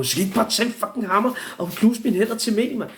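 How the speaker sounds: high-pitched exasperated plea; hammed-up panicky objection; mock desperate protest. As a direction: plead in disbelief, voice jumping way up. Acted pleading protest to the men.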